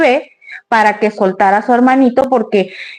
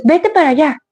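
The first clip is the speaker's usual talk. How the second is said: a sharp, higher "Vete para allá" barked at the dog; curt scolding; 4 semitones higher than usual. Retold or imitated. Owner shoos off the angry dog, harsh and curt. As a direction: shoo the angry dog away impatiently. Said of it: "Él se anda enojado y le dices".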